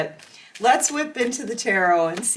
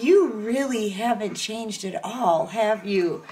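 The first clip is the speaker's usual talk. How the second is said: mild disbelief. Retold or imitated. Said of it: "You're gonna be like".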